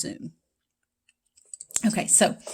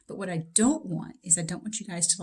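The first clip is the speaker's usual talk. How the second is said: ordinary delivery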